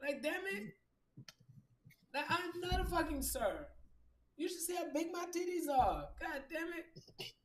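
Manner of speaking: high, exasperated mock outrage; indignant retort. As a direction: pitch way up, fed up at being called sir. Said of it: "I'll be like".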